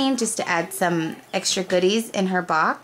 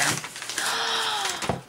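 high, soft gasp; soft, high intake of breath; amazed gasp; 12 semitones higher, 6 dB quieter than usual. Real, not acted. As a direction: gasp in delighted surprise. Unwraps the last gift.

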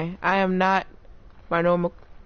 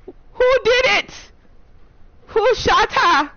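high, slow, loud demand; outraged; 13 semitones higher, 5 dB louder, much slower than usual. Real, not acted. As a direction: demand to know who did it. Teammate shot dead.

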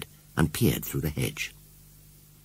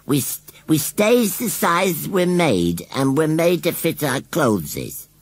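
sulky scarecrow; 8 semitones higher, 5 dB louder than usual. Acted. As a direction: sulk like a stubborn old scarecrow. Gummidge explaining.

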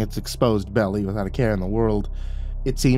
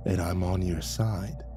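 low, soft dragon voice, hushed; an ancient beast sharing a secret plan; dragon confiding; 3 semitones lower, 8 dB quieter than usual. Acted. Dragon secretly recruits the keeper, low and hushed.

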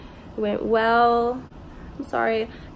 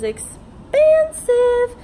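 exaggerated outrage; 13 semitones higher, 8 dB louder, much slower than usual. Real, not acted. Complaint about the medication price.

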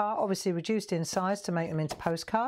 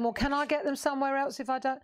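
higher, quick mimicked question; imagined customer; 7 semitones higher, faster than usual. Host voices a viewer's question, quick higher voice. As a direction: lightly voice a customer, pitched up and fast. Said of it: "And if you're saying".

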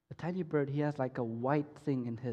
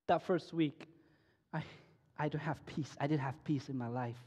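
normal talking voice

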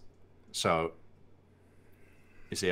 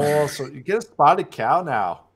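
higher, louder, slow hammed-up Minnesota accent; drawn-out Minnesotan put-on voice; mock Minnesotan accent; 6 semitones higher, 5 dB louder, slower than usual. Teasing a co-host over his bad impression, mock Minnesotan accent. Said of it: "that's more Minnesotan".

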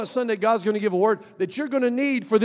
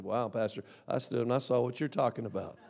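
quoting the coach; 7 semitones lower, 10 dB quieter than usual. Retold or imitated. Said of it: "He said".

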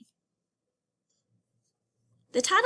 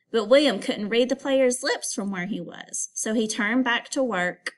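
lively read-aloud storytelling; story reading. Acted. Teacher reading a picture book aloud to students. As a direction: read the story to the class with expression.

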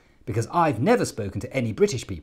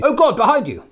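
high, loud mock alarm; fake panic; 12 semitones higher, 8 dB louder than usual. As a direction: cry out as if something's behind them. Fake alarm to startle audience.

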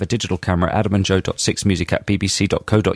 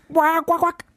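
synth stab noises. Pretend. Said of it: "Sing [name] by [name]".

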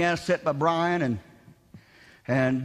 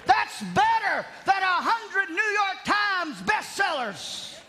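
raised, ringing sermon voice, higher than his chat; preaching; 10 semitones higher than usual. Acted. Pastor preaching to his congregation, in a raised ringing preaching voice. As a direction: preach it out to the church with full conviction.

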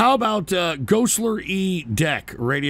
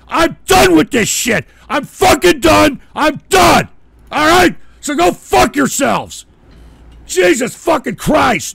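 loud, high, real rage; furious yelling; 4 semitones higher, 5 dB louder than usual. Real, not acted. Host quitting the show on his audience, yelling. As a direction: slam the door on everyone with your voice.

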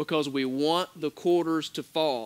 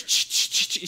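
clinking coin noise. Retold or imitated. Preacher mimics quarters pouring from machine, clinking. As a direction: spit out quarters clattering.

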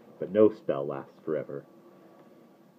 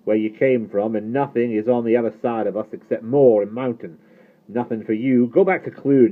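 loud, high, irate tirade; angry shouting; 5 semitones higher, 9 dB louder than usual. A narrator's reading. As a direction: drive the boy off your land. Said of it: "All at once he was shouting".

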